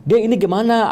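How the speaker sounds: retold angry demand, pitched high; kesal marah-marah. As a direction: raise the pitch in exasperated complaint. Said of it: "Aku udah marah-marah ya". (menirukan omelan kesal dengan nada tinggi)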